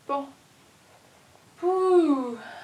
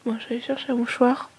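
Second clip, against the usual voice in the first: sniffling. Real, not acted. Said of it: "j'aime pas parler quand je renifle".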